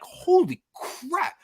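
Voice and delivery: high, drawn-out shocked cry; shocked exclamation. Retold or imitated. Stung by a hornet, retelling his shocked cry. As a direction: yelp it out as the sting hits.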